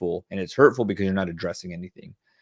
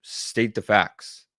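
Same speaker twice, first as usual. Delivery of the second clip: slow factual delivery; much slower than usual. Shown as a demonstration. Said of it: "Don't sound dramatic".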